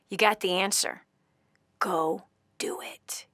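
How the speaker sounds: slow, soft whisper of encouragement; host whispering a dare to the caller; whispered push. Real, not acted. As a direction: nudge her under your breath. Urging the caller intimately.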